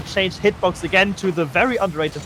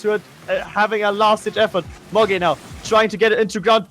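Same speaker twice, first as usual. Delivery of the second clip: ordinary talk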